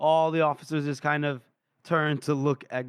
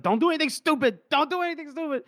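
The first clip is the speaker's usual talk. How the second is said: high-pitched, urgent mock plea; pleading warning; 11 semitones higher, faster than usual. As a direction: beg him in a high voice. Younger voiced pleading with Gorse.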